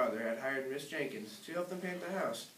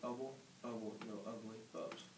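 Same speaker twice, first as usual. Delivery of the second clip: soft unvoiced secret chant; whispered spell; 5 semitones lower, 15 dB quieter than usual. Patrick whispers his secret command to the bubble blower. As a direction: whisper the magic words so only the machine hears. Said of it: "then he whispered".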